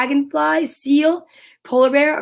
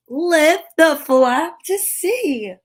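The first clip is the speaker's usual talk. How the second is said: slow, drawn-out invitation; slow teasing; 5 semitones higher, much slower than usual. Acted. Inviting kids to peek, slow and teasing. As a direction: stretch each word to build suspense.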